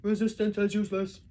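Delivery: loud guard slogan; shouting guard. A narrator's reading. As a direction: bark it mechanically. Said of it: "the guard shouting again".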